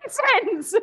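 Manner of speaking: squeaky high correction. Acted.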